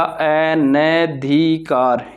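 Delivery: अनधिकार slowly, as "a-na-dhi-kaar"; syllable-by-syllable spelling. Shown as a demonstration. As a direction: break अनधिकार into drawn-out syllables. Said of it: "तो ये बनेगा".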